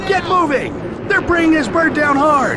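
high, loud urgent yelling; frantic commander shouting everyone out; shouting over chaos. Acted. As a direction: bark orders over the roar of a crash. Escaping a crashing plane.

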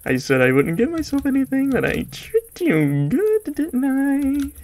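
very high, taunting gloat. Acted. Gamer taunting viewers in a high voice. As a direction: tease them like a sly cartoon villain.

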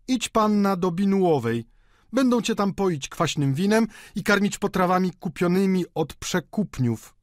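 high-pitched, spiteful retort; heated, disdainful outburst; scornful. A narrator's reading. Landlady scorns rival's lodging house.

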